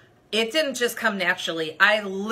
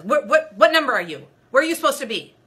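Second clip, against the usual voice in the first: louder, sharp demand; harsh volunteer; 5 semitones higher, 7 dB louder, faster than usual. Retold or imitated. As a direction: snap at her like an impatient volunteer. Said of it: "she was so harsh".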